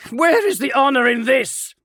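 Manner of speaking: high, loud impassioned plea; rally your brothers to mercy; impassioned marine. Acted. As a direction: Kelefon pleads for mercy, loud and passionate. Kelefon continues plea.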